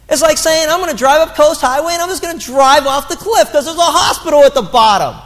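rising pulpit fervor, pressing each line home; impassioned sermon delivery; preaching at full force. Acted.

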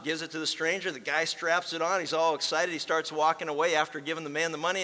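ordinary delivery

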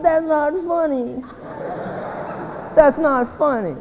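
high, put-on voice of disapproval; drawn-out, high mock complaint; mock offended listener. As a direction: mimic a humorless churchgoer objecting. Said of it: "some of you are sitting there going".

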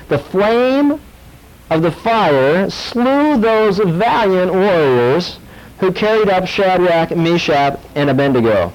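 slow, high, drawn-out scripture reading; dramatic reading. Acted. The preacher reads the blazing furnace to the congregation, slowly. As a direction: stretch the words for drama.

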